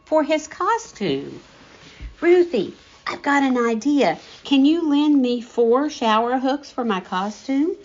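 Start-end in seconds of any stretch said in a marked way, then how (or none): 2.2-7.8 put-on high, eager kid voice bursting with an idea; a boy who can't wait to share his plan; pitched-up excited child asking a friend; bubbling with excitement. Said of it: "bubbling over with excitement".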